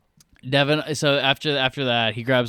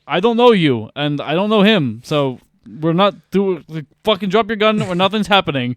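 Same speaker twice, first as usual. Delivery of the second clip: raised, higher-pitched protest; mock firm order; 6 semitones higher, 6 dB louder than usual. Guard tells Bond to drop the gun, mock raised voice. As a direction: retell a tense standoff, voice pushed up.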